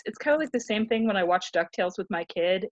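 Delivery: ordinary talk, nothing marked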